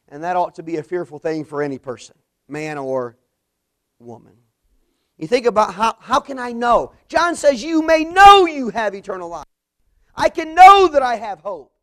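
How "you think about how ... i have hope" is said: high, loud preaching cry; full-force preaching. Acted. Preacher proclaiming John's promise to the church, loud preaching. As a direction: lift it to a pulpit climax. Preacher quotes John on assurance.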